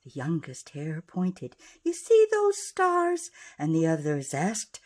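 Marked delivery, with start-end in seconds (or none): 1.8-3.3 excited question. Acted. Youngest hare pointing excitedly.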